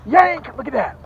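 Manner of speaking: big, loud, high yell as the hook is set; excited shout. Real, not acted. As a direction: shout it out as you jerk the rod.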